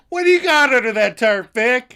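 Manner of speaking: high, loud theatrical bellow; random stage quote. Acted. Host randomly quoting the play at home, hammed-up shout. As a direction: belt it out like a stage line. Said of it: "I'll just be making dinner".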